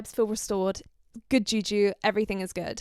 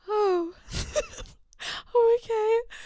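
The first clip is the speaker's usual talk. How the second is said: high, strained mock-polite reaction; awkward dismay; 13 semitones higher, 4 dB louder, slower than usual. Seeing her date with a prettier girl, high awkward dismay. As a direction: take it in with a small, high laugh.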